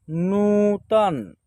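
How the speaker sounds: नूतन slowly, with a long नू; drawn-out model reading. Shown as a demonstration. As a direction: stretch the first vowel of नूतन. Teacher models the blended word.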